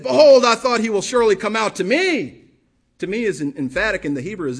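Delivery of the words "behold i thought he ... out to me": loud indignant outburst; he is insulted, let it ring; furious. Acted. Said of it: "Naaman was furious".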